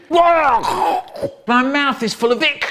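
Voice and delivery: high, drawn-out retching wail; disgusted outcry. Acted. Neighbour finding out what he ate, disgusted wail. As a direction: wail it out slowly, gagging on the mouthful.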